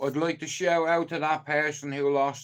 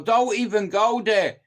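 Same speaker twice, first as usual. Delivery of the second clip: raised warning; 8 semitones higher, 8 dB louder than usual. Real, not acted. Warning United fans off.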